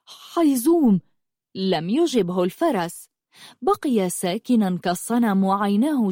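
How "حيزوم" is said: higher, eager call to a friend. Acted. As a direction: call out longingly to your horse. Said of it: "وناداه متلهفا".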